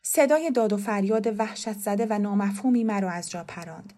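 plain reading voice

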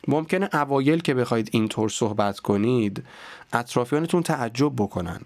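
ordinary talk, nothing marked

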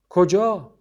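put-on calling after the boy; sudden call; sharp question. A narrator's reading. Grandfather calls after fleeing boy.

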